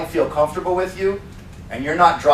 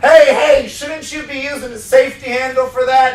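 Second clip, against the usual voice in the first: high, loud, cheerful coaching of a trainee; mock trainer voice; 7 semitones higher, 4 dB louder than usual. Trainer acting out a reminder to a trainee, cheerful and high. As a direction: play the helpful trainer warning the new guy.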